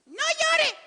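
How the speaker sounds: squeaky-high loud mock voice; joke voice of Jesus. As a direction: blurt it in a comically high pitch. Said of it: "el chiste de la semana pasada".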